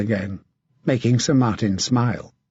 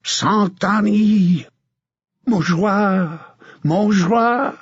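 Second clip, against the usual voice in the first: French knights yelling their battle cry, higher; screamed war cry; 8 semitones higher, much slower than usual. Acted. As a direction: scream it as you charge. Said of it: "They were screaming".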